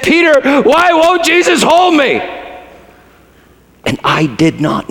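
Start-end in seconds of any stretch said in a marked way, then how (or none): 0.0-2.2 high, wounded question to Peter; the woman asking Peter, high and aching; hurt plea. Retold or imitated. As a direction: ask it like a bewildered, hurting child. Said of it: "she turned to me and she said".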